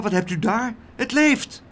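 high excited exclamation; calling out. A narrator's reading. Laura discovers something alive among the blankets, calling out. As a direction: pitch climbing in amazement. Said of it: "riep Laura".

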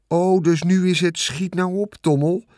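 sarcastic mimicry of "schiet nou op", lightly voiced; mocking Wilbur. The rat mocking Wilbur's plea to hurry, sarcastic mimicry. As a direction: parrot the pig's plea mockingly. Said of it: "Hij begon Wilbur na te praten".

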